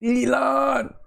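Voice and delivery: high excited call of a name. Real, not acted. Streamer calls out to Elon as the coin pumps, high excited call. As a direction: call his name out high, as if he could hear.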